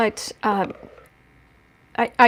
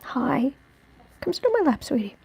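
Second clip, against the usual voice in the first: coaxing pet talk; 3 semitones higher, 4 dB quieter than usual. Real, not acted. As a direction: invite the dog up gently. Calling dog onto lap.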